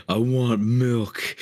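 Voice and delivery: put-on slow whiny baby voice. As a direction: whine it like a toddler. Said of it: "like a baby".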